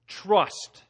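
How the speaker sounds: ordinary talk, nothing marked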